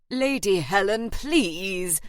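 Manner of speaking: pleading protest from a scandalised agent; embarrassed, urgent remonstrance; expostulating. A narrator's reading. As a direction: implore her to stop. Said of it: "the agent expostulated".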